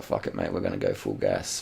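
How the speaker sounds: gruff rider voice. Retold or imitated. Mock rider talk, low voice.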